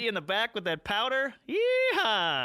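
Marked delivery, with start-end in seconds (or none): 1.5-2.5 high, gleeful yeehaw; drawn-out high holler; cowboy whoop. Acted. Cowboy whoop after powder slap.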